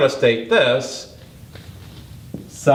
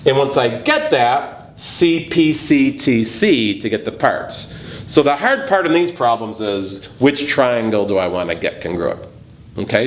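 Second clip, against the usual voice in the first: ordinary talk, nothing marked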